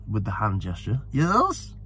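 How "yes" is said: high-pitched regal impression; Queen Mother. Royal answering a shouting racegoer, imitated in a high voice. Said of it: "so I'll do the voice".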